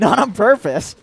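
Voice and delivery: laughing high and loud. Real, not acted. Laughing denial.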